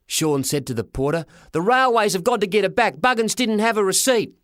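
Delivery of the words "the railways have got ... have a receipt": put-on high, forceful kid's protest; high-pitched boyish outrage; indignant boy. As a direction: argue hotly in a high voice. Boy argues with porter.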